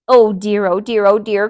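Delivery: loud dismayed cry. A narrator's reading. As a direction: wail it out in dismay. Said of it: "cried Delilah".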